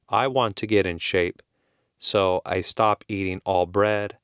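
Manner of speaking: ordinary talk, nothing marked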